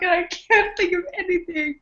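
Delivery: high laugh-cry, can't stop laughing; laughing hard. Real, not acted. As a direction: laugh through the words helplessly. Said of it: "screwing with her ability to think".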